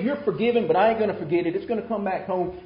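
loud, high mock pardon with strings attached; grudging forgiveness. What Jesus didn't say to the woman, loudly grudging. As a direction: blurt it as someone who won't let it go. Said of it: "He didn't say".

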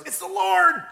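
high-pitched cry of recognition; excited calling out. Retold or imitated. John hauling the net, calling up to Peter, excited calling out. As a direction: call it out in sudden excitement.

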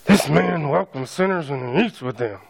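a lightly voiced disgruntled Pharisee; grumbling Pharisee. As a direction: mutter it under your breath like an indignant Pharisee. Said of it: "The religious leaders mutter".

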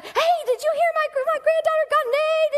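high, fast bragging grandma voice; lightly voiced delighted grandmother; proud grandma. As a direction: show off her granddaughter.

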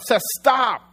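put-on high, loud shout after a runaway child; yelled warning. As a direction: yell sharply to halt your son. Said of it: "I yell out".

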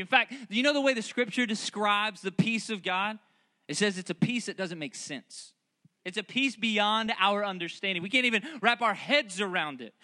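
high, pressing preacher's call; a preacher building to an urgent invitation; fervent preaching. Acted. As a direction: sell the joy and peace to the room. Preaching at full force.

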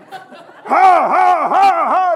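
loud, high pumped-up "ha" chant; explosive team hype shouts; locker-room war grunts. Acted. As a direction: bark out rhythmic huffs before the big game. Acting players bashing helmets before game.

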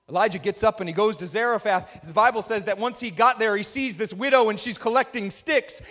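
usual speaking voice

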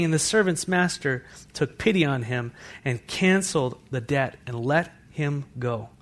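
normal talking voice

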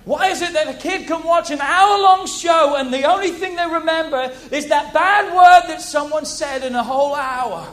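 loud, driving preacher's voice; full-force preaching. Real, not acted. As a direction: preach it to the congregation. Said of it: "I'm preaching now".

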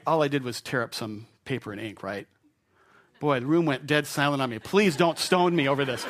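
ordinary talk

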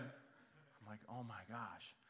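embarrassed mutter. Retold or imitated. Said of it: "embarrassed dad at counter".

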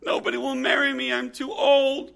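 high-pitched tearful wail, imitated; hammed-up weepy young woman; mock crying. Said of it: "and she was crying".

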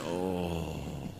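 weary dismay. Retold or imitated. His weary reaction to boasting.

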